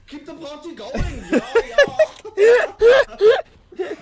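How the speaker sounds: put-on high, loud German party accent with laughter; mock German accent. Friend plays German partygoer, mock German accent. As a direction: laugh through a German raver act. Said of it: "someone who's moved over from Germany".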